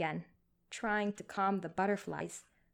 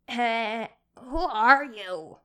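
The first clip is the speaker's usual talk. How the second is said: put-on craggy cranky old man; 3 semitones higher, 10 dB louder, much slower than usual. A narrator's reading. Elderly man refusing the nurse through the door, cranky. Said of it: "craggy, cranky man's voice".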